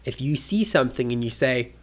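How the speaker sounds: normal talking voice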